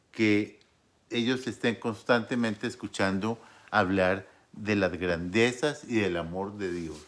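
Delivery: normal talking voice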